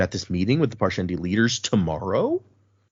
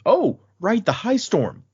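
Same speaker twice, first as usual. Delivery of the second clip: high "oh" of mild surprise; retold dawning realization, pitched up; sudden realization; 9 semitones higher, slower than usual. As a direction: remember it suddenly, lightly acted. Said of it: "she realizes".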